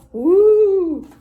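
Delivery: high, loud ghostly woo; a playful spooky hoot to thrill the kids. A narrator's reading. Spooky reveal.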